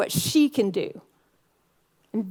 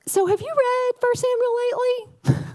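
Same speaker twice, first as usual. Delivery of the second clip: much higher, sing-song question to her husband; coy high voice; playful high question; 12 semitones higher than usual. Retold or imitated. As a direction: tease him sweetly at bedtime. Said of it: "I was like".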